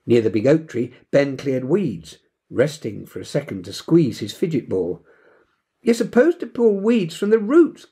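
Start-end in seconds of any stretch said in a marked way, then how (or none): 5.8-7.9 high, louder bossy correction; commanding. A narrator's reading. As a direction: boss the boy about weeding. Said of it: "commanded Janie".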